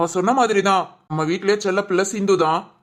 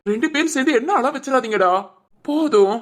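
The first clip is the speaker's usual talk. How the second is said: higher-pitched, upset plea to stop; raised, emotional protest; pleading; 6 semitones higher than usual. Acted. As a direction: beg the two of them to stop crying. Begging both siblings to stop crying.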